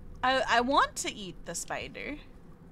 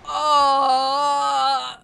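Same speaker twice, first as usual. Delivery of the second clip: loud drawn-out frightened oh; fearful wail; 11 dB louder than usual. Real, not acted. Gamer crying out in fright at a sudden threat. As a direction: let out a scared wail.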